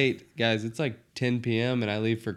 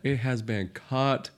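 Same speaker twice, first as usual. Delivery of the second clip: slow mock Southern drawl; 2 semitones higher, slower than usual.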